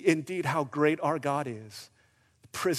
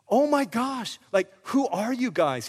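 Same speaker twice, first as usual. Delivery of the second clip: hammed-up, higher amazement; mock awe; 7 semitones higher, 4 dB louder than usual. Jailer gawping at Paul and Silas, mock awe. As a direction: play at being starstruck.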